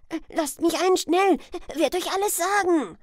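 put-on loud, high boy's cry for entry; urgent plea. Huck outside begging the old man to let him in, urgent shout. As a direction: beg frantically at full volume.

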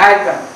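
"अमेरिकन" with the first ए swallowed, starting on "मेरिकन"; drop the A. Shown as a demonstration. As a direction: clip the opening vowel off "अमेरिकन". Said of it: "ए को खा जाना है". ("अमेरिकन" शुरू का ए खाकर, सीधे "मेरिकन")

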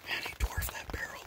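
hushed, unvoiced-sounding whisper from the blind; excited news kept to a whisper; hunter's whisper. Real, not acted. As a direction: whisper low so the animals don't hear.

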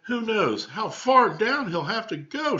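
put-on high, slowing, ominous child's voice; dramatic little girl. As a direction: build the suspense like a kid at a campfire. Elaine dramatising danger.

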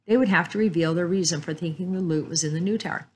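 ordinary delivery, nothing marked

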